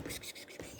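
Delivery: unvoiced kss-kss to a kitten; whispered kitty call. Real, not acted. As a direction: hiss softly to coax a kitten. Calling kitten.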